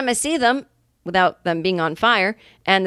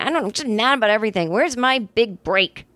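ordinary talk

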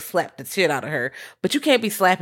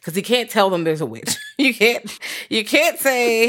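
ordinary talk

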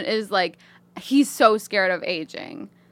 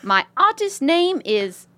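put-on British lady accent. Reading a listener's email as a British lady, British accent. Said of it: "British lady writing this email".

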